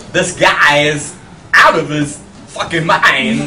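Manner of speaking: slow, halting imitation of the actor; Christopher Walken impression. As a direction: drag it out with strange pauses, imitating Walken. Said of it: "if Christopher Walken said it".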